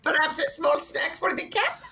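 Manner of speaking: very high, slow, put-on voice; imitated cat-joking salesman. Repeats the salesman's cat joke.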